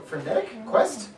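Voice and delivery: ordinary talk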